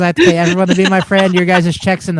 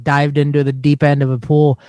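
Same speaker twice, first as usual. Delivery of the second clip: ordinary talk, nothing marked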